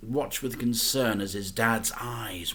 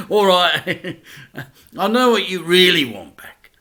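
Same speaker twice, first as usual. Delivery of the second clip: louder, chuckling dad voice; laughing dad; 8 semitones higher, 12 dB louder than usual. A narrator's reading. Dad teases his son, chuckling. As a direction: tease him through laughter.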